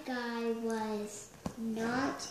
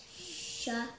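ordinary delivery, nothing marked

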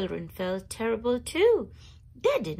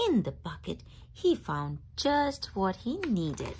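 plain reading voice

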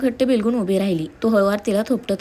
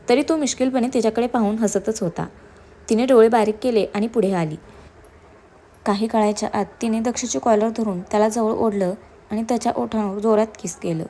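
plain reading voice

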